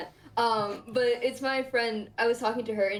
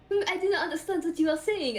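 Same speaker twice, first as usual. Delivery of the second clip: higher, mock-baffled French accent; imitated French friend; 6 semitones higher than usual. French friend not understanding the pronunciation, baffled French accent. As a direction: feign incomprehension in a French accent. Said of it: "And she's like".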